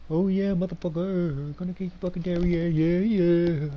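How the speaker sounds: put-on loud drunken taunt, slurred; belligerent drunk. Same chant said twice.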